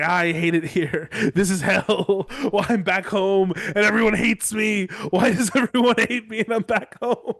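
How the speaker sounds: laughing, hammed-up wail of self-pity; mock whining. Humiliated man at the feast, mock whining through laughter. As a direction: whine it out, cracking up between phrases.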